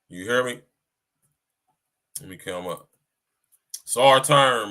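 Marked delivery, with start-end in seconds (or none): 3.8-4.7 louder "It's our turn" punched on "our"; rallying shout; loud catchphrase. Retold or imitated. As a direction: call it out like a rallying cry.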